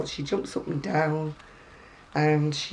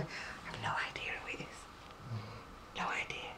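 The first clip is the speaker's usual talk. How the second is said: whispering; 6 semitones lower, 16 dB quieter than usual. Real, not acted. Someone waving outside her window.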